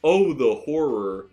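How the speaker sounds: slow, hammed-up gasp of horror; theatrical fake dread; mock horror. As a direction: play the horror big and slow. Mock dismay at chocolate theft.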